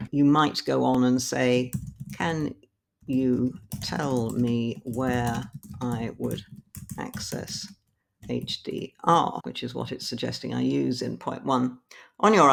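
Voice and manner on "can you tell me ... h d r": slow dictation. Shown as a demonstration. Reading a typed prompt aloud.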